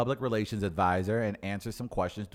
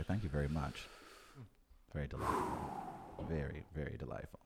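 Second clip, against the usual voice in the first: sarcastic whisper; 8 semitones lower, 12 dB quieter than usual. Acted.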